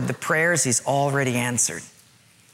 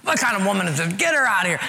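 mock fed-up husband, pitched up; lightly acted indignant griping; grumbling; 5 semitones higher, faster than usual. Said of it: "now we're just grumbling".

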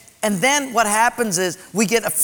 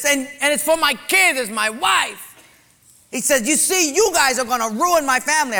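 higher, upset retold plea; angry pleading; 7 semitones higher than usual. Man yelling at pastor.

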